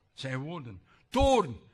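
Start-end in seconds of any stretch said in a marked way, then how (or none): 1.1-1.6 loud, higher proclamation; proclaimed. Shown as a demonstration. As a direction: call the sin out loud. Loud sin word.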